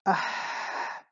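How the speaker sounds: hot unvoiced ah as if something burns; breathy burnt gasp. Shown as a demonstration. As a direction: exhale ah like a burn. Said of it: "Si como te quema algo".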